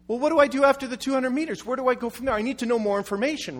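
impatient driver demanding answers; frustrated questioning. Retold or imitated. Pastor venting at the GPS.

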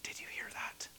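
hushed, unvoiced whisper to the listeners. Shown as a demonstration. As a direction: whisper the question, barely audible. Same question whispered, then voiced.

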